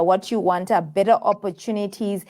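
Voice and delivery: ordinary delivery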